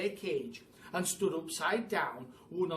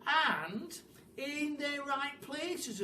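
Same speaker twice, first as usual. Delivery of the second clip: drawn-out, high fussy voice; slow and fussy; 10 semitones higher, much slower than usual. Acted. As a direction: stretch it out fussily. Mugglewump fussy instruction.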